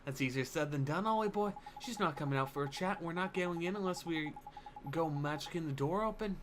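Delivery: a hint of a sceptical fairy sidekick; lightly voiced doubtful fairy. Fairy warning the boy they can't get in, mildly doubtful.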